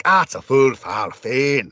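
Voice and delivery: put-on heavy old Scots brogue, bursting out; Scottish accent. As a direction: ham up a period Scotsman you can barely follow. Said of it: "the Scots is like".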